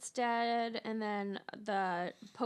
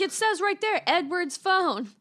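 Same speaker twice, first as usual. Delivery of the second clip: loud, high, insistent; acted indignant retort; 8 semitones higher, 7 dB louder than usual. Man pointing out whose phone it is, loud indignant retort. Acting out argument over phone.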